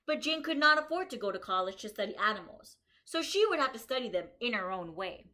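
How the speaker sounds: bright, lively storybook reading for a class; reading to children. Acted. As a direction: read it out to the kids like a story. Reads picture book aloud.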